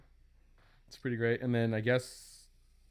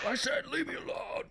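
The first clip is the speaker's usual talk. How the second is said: high but softer metal-singer impression; 12 semitones higher, 6 dB quieter than usual. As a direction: put on the metal frontman, pitched way up. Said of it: "he just talks in Pantera voice".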